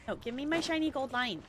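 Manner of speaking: ordinary talk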